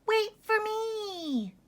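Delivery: slow, high crying plea; high whiny little voice. A narrator's reading. Small lamb crying after the others, slow and high. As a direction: wail it like a little one left behind. Little one left behind.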